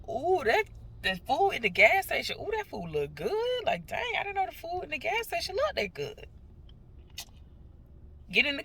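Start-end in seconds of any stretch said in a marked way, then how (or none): 0.1-6.3 high, excited gushing about gas station food; drooling over food. Retold or imitated. On the phone raving about gas station food, high excited gushing. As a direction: gush like the snacks look amazing. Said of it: "talking about".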